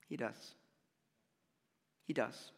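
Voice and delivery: Jesus quietly assuring the listener; gentle reassurance. Acted. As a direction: comfort someone who feels alone.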